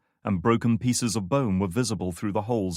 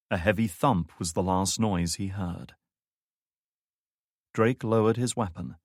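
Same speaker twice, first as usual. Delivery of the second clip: ordinary talk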